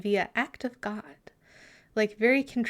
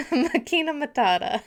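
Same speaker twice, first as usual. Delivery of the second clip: laughing pun; 5 semitones higher, 5 dB louder than usual. Acted. Joking pun sung like Hakuna Matata.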